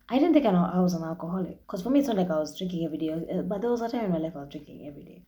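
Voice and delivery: fast, tumbling explanation; rushed. Real, not acted. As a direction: rush through it defensively. Rushed self-justification.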